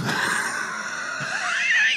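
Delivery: shrieking burst of laughter; high squealing laugh. Real, not acted. Cracking up mid-story, squealing high laugh. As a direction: lose it completely, high and wild.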